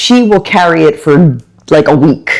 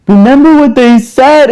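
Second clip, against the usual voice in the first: imitating her daughter; 8 semitones higher, 4 dB louder than usual. Daughter voiced in a story.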